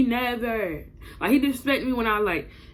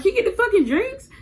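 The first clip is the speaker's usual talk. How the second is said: much higher pitch, rising indignantly; exasperated demand; 12 semitones higher, 4 dB louder than usual. Retold or imitated. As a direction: re-enact losing patience with him. Acted quarrel, demand repeated.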